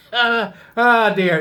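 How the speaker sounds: high, loud, laughing sigh of words; real laughter. Real, not acted. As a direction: slowly get the words out through laughter. Recovering from laughing at own joke.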